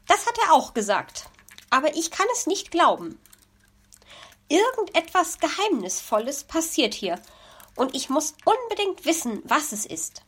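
curious magpie. Acted. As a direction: lean in, dying to know.

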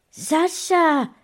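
high, loud call of fright; alarmed cry. A narrator's reading. Friend spotting the boy in trouble, alarmed. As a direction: call out in shock. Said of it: "rief sie erschrocken".

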